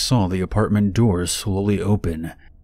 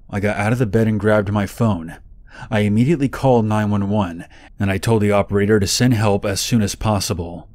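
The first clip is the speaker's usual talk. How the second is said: usual speaking voice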